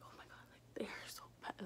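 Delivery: breathy whisper, wincing at the ache; hushed oh-my-God of pain. Retold or imitated. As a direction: whisper it like every step hurts. Retold pain while walking.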